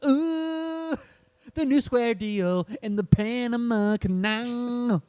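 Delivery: high, slow, lightly hammed-up boast; mock Roosevelt pride. Mocking Roosevelt's achievements with a high, boastful crow.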